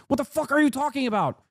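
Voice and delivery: usual speaking voice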